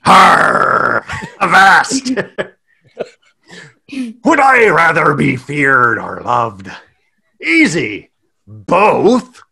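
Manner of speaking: big mock pirate voice, slowing at the end; sarcastic pirate. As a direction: play a hammed-up sarcastic pirate. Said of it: "sarcastic pirate".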